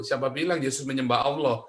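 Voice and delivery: ordinary talk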